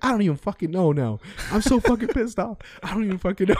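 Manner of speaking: high-pitched exasperated imitation; mock worked-up complaint, pitched well up; pissed off. Angry customer answers the cook about his order, imitated pissed-off whine. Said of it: "He was all pissed off".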